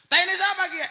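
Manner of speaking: very loud, high shout of disgust; startled yell. Retold or imitated. Ngapak guest yells at Sunda host, very loud and high. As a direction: bellow it as the punchline retold. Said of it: "Langsung dia teriak".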